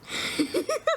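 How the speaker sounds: high squealing laugh. Real, not acted. Player cracking up at the table, high laugh.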